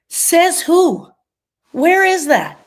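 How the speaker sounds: loud, high challenge: says who; sassy demanding comeback; defiant retort. Acted. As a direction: throw it back at them, indignant. Defiant retort to should statements.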